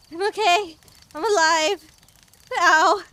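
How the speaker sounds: loud pained yelps; crying out from under the chair. Real, not acted.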